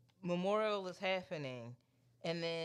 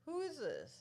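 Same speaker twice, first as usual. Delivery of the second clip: high-pitched, demanding who is this; baby mama confronting the stranger, high and indignant; 11 semitones higher, 4 dB quieter than usual. Retold or imitated. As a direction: pitch it way up like an outraged ex. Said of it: "baby mama's is like".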